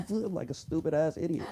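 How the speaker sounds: usual speaking voice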